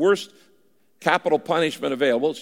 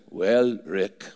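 low-pitched imitation of an Austrian accent; thick Austrian accent; 7 semitones lower than usual. Preacher quoting his mentor's reply, thick Austrian accent. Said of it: "he said in that thick Austrian accent".

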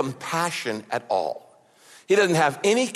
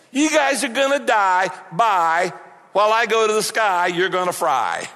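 careless dismissal; 3 semitones higher than usual. Retold or imitated. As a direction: toss it off like you couldn't care less. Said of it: "He doesn't care two bits".

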